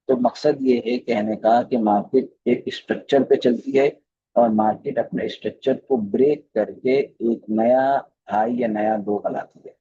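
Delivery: normal talking voice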